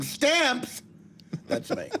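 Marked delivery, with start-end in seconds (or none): 0.0-0.8 high, camp call of the word Stamps; effeminate postal worker. Acted. Host reminds the comic his character is registered, camp call. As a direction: sing out Stamps like a flamboyant postal clerk. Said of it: "if you do see someone going".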